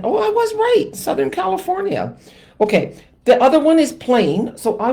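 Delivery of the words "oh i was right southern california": much higher gleeful I was right; real glee; triumphant. Real, not acted. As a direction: crow over being right. Pleased to be proven right.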